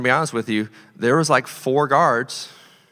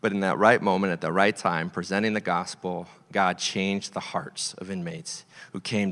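soft earnest preaching; 9 semitones lower, 6 dB quieter than usual. Acted. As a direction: lower the voice to let the truth land. Preaching the climax softly.

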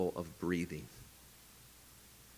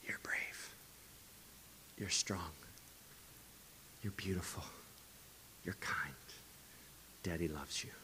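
tender bedtime whisper; a father's gentle goodnight whisper; whispered affirmations; 3 semitones lower, 17 dB quieter than usual. Retold or imitated. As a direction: whisper softly into a child's ear. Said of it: "and I just whisper".

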